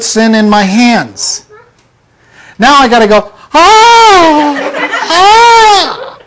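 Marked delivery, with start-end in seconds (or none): 3.5-6.2 high strained mock wail, half laughing; mock anguished cry. Husband crying out in mock anguish at temptation.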